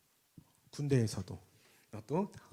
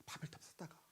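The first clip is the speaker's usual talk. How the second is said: whisper; 3 semitones lower, 18 dB quieter than usual. Acted. Hushed aside in sermon.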